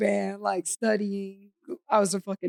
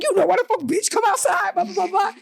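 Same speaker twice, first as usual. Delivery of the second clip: high-pitched mock challenge; mock confrontational; 8 semitones higher than usual. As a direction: imitate a sister calling someone out to fight. Sister confronts girl at door.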